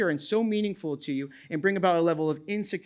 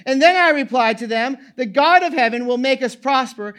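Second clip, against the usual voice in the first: loud, high preaching at full force; fiery scripture reading; 5 semitones higher, 7 dB louder than usual. Acted. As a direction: proclaim the passage to the congregation.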